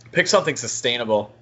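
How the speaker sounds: ordinary delivery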